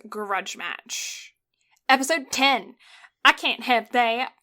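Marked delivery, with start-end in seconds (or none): 3.2-4.3 accidental Southern twang; Southern accent. Real, not acted. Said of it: "went southern for that".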